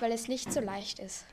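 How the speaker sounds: ordinary talk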